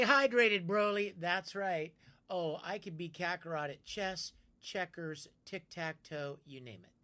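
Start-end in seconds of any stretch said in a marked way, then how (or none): none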